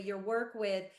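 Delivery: ordinary talk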